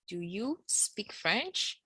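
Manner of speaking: slow soft teacher gloss. Shown as a demonstration. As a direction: say the English slowly and quietly. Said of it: "So it is".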